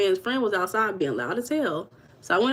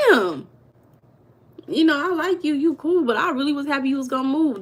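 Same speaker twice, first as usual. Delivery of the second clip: mock disappointment; 5 semitones higher, 4 dB louder than usual. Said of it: "I said".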